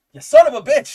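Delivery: loud, high burst of admiring outrage; hammed-up shout of praise at the artist; mock outrage. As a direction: yell it like the art just floored you. Gushing over the comic artist.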